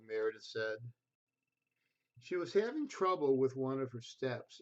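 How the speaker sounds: gentle storybook reading voice; reading to children. A narrator's reading. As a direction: read it like a bedtime picture book. Reads picture book to children.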